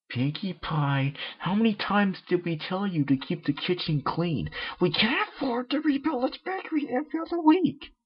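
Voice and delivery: very high, shrill-toned tirade; a high mare scolding hard; scolding Miss Cake. Acted. Miss Cake scolds Pinkie in a very high tirade.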